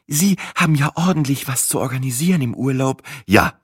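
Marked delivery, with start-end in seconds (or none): none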